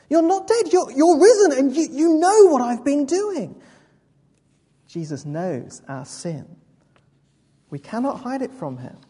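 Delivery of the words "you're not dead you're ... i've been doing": high, loud, stammering realisation; astonished outburst. Acted. Saul grasping that Jesus has risen, astonished outburst. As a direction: burst out in shocked amazement.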